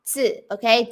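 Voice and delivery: usual speaking voice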